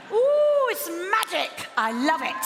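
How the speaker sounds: high, loud squeal of delight; excited gushing. Real, not acted. Host to audience about the Royal Albert Hall, excited gushing. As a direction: gush with a high whoop.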